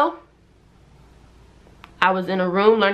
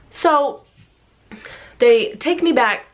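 normal talking voice

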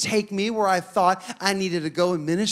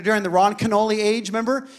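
ordinary delivery

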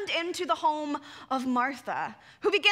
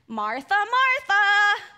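loud, slow, scolding Martha, Martha; finger-wagging chiding; 5 semitones higher, 7 dB louder, slower than usual. Shown as a demonstration. Jesus scolding a busy Martha, loud chiding. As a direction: scold her name like a stern parent. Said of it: "imagine Jesus waving his finger".

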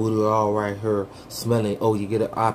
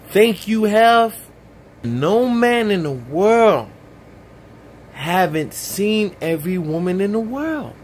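ranting; 7 semitones higher, slower than usual. Acted. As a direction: preach it, hit every word. Ranting preacher-style.

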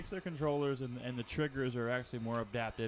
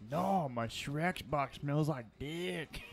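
slow, higher mock ogre voice; hammed-up ogre correction, words stretched out; Shrek; 4 semitones higher, much slower than usual. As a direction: imitate an indignant cartoon ogre. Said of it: "He's like".

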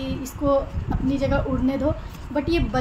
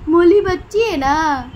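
higher, slow, fond, stretching बच्ची with a long fall; 3 semitones higher, 6 dB louder, slower than usual. Real, not acted. Fond baby talk about the bird. (छोटे बच्चे की तरह प्यार से, "बच्ची" को लंबा खींचकर)

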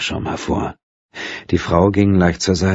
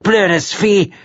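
high, loud spiteful snap; spiteful curse; 4 dB louder than usual. A narrator's reading. Woman cursing the cat she just kicked, spiteful. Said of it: "gehässigen Tritt".

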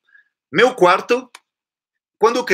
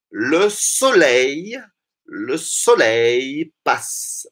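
slow; much slower than usual. Shown as a demonstration. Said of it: "Alors on y va".

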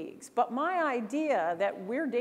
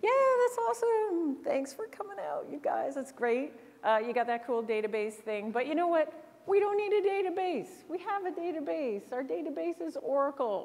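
high, breezy brush-off voice; mock a polite executive waving them off; dismissive senior exec; 5 semitones higher than usual. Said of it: "put up her hand and say".

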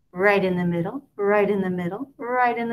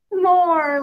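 loud high giggly "More"; a delighted baby demanding more; laughing; 8 semitones higher, 8 dB louder than usual. A narrator's reading. Baby laughing at grandma for more toe-tasting. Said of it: "laughs Little Pumpkin".

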